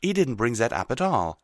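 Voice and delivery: emphatic protest; insistent. A narrator's reading. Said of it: "Twilight insisted".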